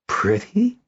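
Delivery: PRETTY as a high, rising question; incredulous exclamation. A narrator's reading. Kindon disputes Noella's praise, PRETTY rising in disbelief. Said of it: "Kindon exclaimed".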